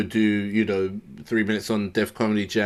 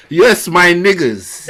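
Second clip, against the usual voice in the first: mock hype greeting; 6 semitones higher, 7 dB louder, much slower than usual. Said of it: "greeting the crowd, mock".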